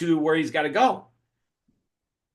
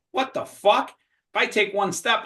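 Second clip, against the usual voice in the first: mock alarm, pitched up; alarmed disbelief; 6 semitones higher, slower than usual. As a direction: play the hero balking at the drop. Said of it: "he's like".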